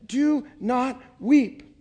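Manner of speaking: slow, tender, drawn-out comfort; gentle command. A narrator's reading. Jesus comforts the widowed mother, slow and tender. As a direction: soothe a grieving mother slowly. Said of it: "and he said".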